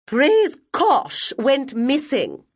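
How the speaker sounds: high, slow, sour guardian voice; sourly disapproving matron; sour Guardian Hope. Acted. Guardian sourly telling the class about the aunt. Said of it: "said Guardian Hope sourly".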